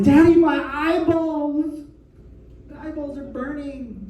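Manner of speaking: put-on high, slow whiny kid voice; whiny child. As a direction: whine like a little kid with stinging eyes. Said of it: "My kids are like".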